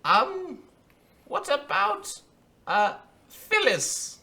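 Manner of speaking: a nervous little official, dragging out the name; squeaky clerk voice. Acted. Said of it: "he also says".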